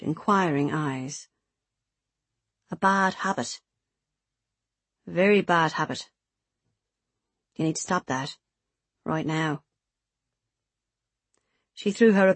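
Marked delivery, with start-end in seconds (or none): 2.7-9.6 lightly voiced scolder, measured short phrases; disapproving Kaylee. Kaylee warning Beth off smoking, sternly.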